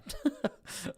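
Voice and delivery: a quick airy laugh at himself; breathy laugh. Real, not acted. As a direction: laugh it off, unvoiced. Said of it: "I think I just swallowed a fly".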